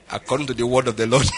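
ordinary delivery

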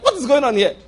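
high, laughing puzzlement; the vice chancellor stares, laughing, confused; amused bewilderment. Retold or imitated. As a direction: play baffled through a laugh.

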